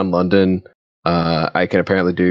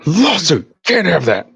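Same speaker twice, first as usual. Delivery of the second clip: high cartoonish objection; high mock scolding; mock legal threat; 9 semitones higher than usual. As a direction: play a prissy company refusing, pitched way up. Company voice in mock skit.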